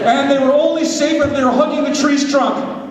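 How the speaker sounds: lively storybook narration; storytelling narration. Acted. Preacher narrating a skit to the congregation, storybook style. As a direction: tell it like a children's tale.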